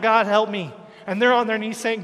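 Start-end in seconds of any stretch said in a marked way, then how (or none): 0.0-0.7 loud plea for help; retold crying out. Woman on her knees to God, loud retold cry. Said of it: "on her knees crying out".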